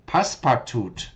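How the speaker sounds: Passepartout as pass-par-toot, firm; chosen pronunciation. Shown as a demonstration. Teacher settling on a pronunciation. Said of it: "I'm going with this one".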